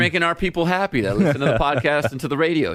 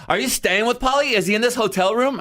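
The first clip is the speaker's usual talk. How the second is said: high eager question; imitating curious fan; 11 semitones higher than usual. Girl asking JC about Pauly, high eager. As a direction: fish for gossip excitedly. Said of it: "this girl starts asking me".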